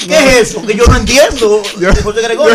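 mock baffled outburst. Panelist jokingly protests he can't decode the message, mock exasperated yell. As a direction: ham up not getting the riddle.

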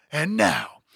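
booming show announcer, building to a shout. Pretend. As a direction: hype it like a game-show intro. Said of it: "if you want me to introduce it".

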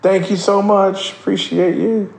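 high, sugary thank-you voice; sweet auto reply. Retold or imitated. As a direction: chirp a canned thank-you. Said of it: "So I'll just have an auto reply".

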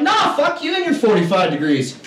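high-pitched, dismissive brush-off; retold defiant refusal. Player turns down a rematch, defiant. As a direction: wave them off, still sore. Acted refusal to play again.

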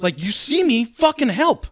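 high, loud mock rage at the corporal; yelling up for help, fed up; hammed-up furious shout; angry yell. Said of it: "I'm like".

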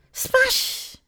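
very high, whispery little splash; imitating cute toddler. Mum imitating her son in the bath, cute toddler voice. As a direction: copy a tiny child's splashy exclamation. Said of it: "got a very cute voice".

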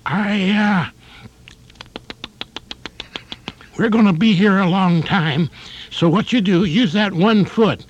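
put-on a frail old hoofer fretting in a stuck lift; elderly tap dancer. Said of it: "An elderly tap dancer trapped in an elevator".

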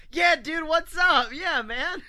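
high, loud friendly greeting; excited greeting. Retold or imitated. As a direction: hype up a fellow fan. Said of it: "I'll still be like".

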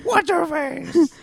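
high, slow squeaky cartoon voice. Quoting cartoon characters.

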